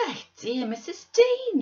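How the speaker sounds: high, loud, drawn-out cry of surprise; exclaiming. Acted. Zillah greets Missus Dean on finding her, exclaiming. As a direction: exclaim it high, stretching the words out. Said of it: "she exclaimed".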